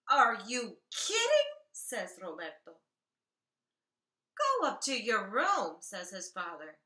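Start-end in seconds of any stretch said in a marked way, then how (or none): 0.1-1.5 loud. Acted. Child protesting spinach dinner. 4.4-5.8 angry. Acted. Father sending son to room.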